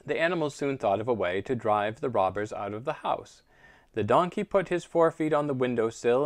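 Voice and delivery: usual speaking voice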